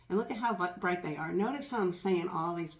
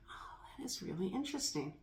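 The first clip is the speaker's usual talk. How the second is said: hushed, wondering whisper; 7 dB quieter than usual. Real, not acted. Reacting to her body's shift, soft amazed whisper. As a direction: breathe it out in awe.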